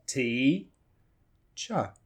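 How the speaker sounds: TEACHER split into a long "tee", a gap, short "cha"; two separated syllables, "T" and "cha". Shown as a demonstration. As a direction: pull "T-cha" apart slowly. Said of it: "So you have two syllables".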